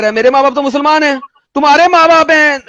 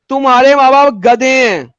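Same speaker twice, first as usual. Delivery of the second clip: slow, drawn-out pronouncement; declaimed quote; slower than usual. Acted. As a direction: spell it out word by word, slowly. Quoting scripture at opponent, emphatically.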